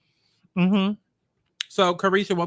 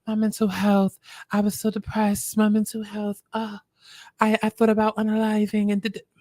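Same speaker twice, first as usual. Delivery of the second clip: higher-pitched mock-distressed quote; put-on woe, pitched up; mocking complaint; 4 semitones higher than usual. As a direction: mimic someone pleading about their troubles. Mockingly quoting star's distress.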